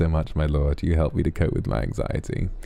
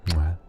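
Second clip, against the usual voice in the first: 1 kiss sound. Pretend. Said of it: "If I get a kiss".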